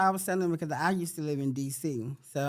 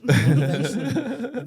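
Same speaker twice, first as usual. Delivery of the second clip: laughing drawn-out word; 9 dB louder, much slower than usual. Real, not acted.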